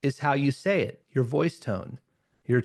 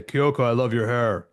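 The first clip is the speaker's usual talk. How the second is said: slightly lower, unsettling admirer voice; creepy compliment; 3 semitones lower than usual. Shown as a demonstration. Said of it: "That's kind of maybe even creepy and scary".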